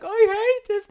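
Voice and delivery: very high, wailed imitation of crying; mock bawling. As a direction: whine it like a tearful child. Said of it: "the two of us were sitting crying".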